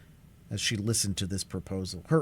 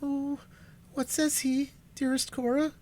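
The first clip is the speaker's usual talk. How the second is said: put-on high, frightened young sister's plea; trembling young voice. A narrator's reading. Alice begging her sister Cora for an answer, high and frightened. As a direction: ask it high and fearful like a scared girl. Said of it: "asked the trembling voice of Alice".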